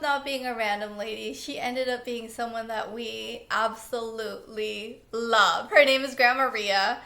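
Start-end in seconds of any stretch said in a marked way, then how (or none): none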